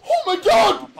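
high, loud exclamation; excited shout. Real, not acted. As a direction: yell in thrilled disbelief. Excited about the next round.

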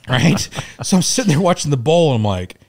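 ordinary delivery

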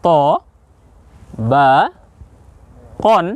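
"ত ... বা ... কন" with long pauses between; split-up model reading of "ত বা কন"; syllable by syllable. Shown as a demonstration. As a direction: read "ত", "বা", "কন" one at a time. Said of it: "বলুন".

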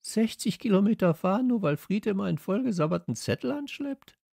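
high-pitched, protesting character voice; Steffi indignant. A narrator's reading. Girl objects to the long trip, indignant.

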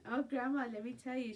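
ordinary talk, nothing marked

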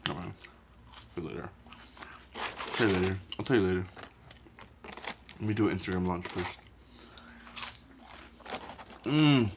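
chewing between words; talking while chewing. Real, not acted. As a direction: mouth full of fries. Seen 1: Fry put in mouth at +0.6, jaw chewing while talking after.

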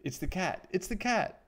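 high mouse cry, climbing on the repeat; alarmed cry. A narrator's reading. Scared mouse sees two eyes gleaming, high alarmed cry. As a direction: raise the alarm in a high little voice. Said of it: "he cried".

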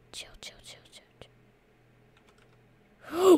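hushed whisper, fading out; whispering chill over and over while hiding. Real, not acted. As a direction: whisper so the monster won't hear. Whispering to hide.

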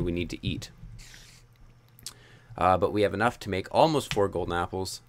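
ordinary talk

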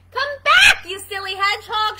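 loud, high call after someone. Acted. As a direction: call after a runaway hedgehog. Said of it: "she called".

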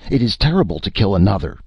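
high, rapid horrified protest; mild acted scream. A narrator's reading. The chief protests at the killing, half screaming. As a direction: cry it out fast and appalled. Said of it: "the chief screamed".

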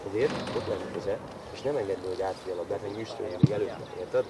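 low, soft coaching aside; quiet explanation. Real, not acted. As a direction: explain it privately to one player. Coach explains privately to one player.

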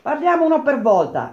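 high call to order; shouted. A narrator's reading. Chairman calls the arguing workers to order, shouting. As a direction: call out over a noisy meeting. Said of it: "gridò Del Buono".

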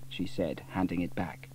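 ordinary delivery, nothing marked

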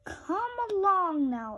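high, hollow skeleton call. A narrator's reading. Skeleton luring the boy in the graveyard, high and eerie. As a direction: beckon Gus like a spooky skeleton.

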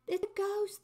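high, loud frightened cry; a mock cry of fear, pitched high; fearful cry. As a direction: shriek it like scared fishermen. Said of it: "They cried out in fear".

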